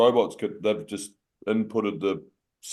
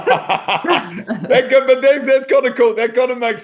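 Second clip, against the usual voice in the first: laughing; 13 semitones higher, 8 dB louder, slower than usual. Real, not acted. Laughs at robot coffee story.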